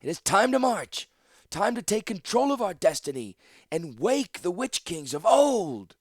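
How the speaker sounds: high, loud call to arms; rally them to march, building to a cry; ringing battle speech; rousing oration. A narrator's reading. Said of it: "Latilla's oration".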